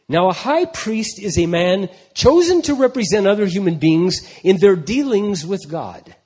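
loud, rising preached reading; ringing pulpit proclamation; full-force scripture reading. Acted. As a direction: preach the passage over the music. Reads Hebrews with full force.

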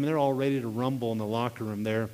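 normal talking voice

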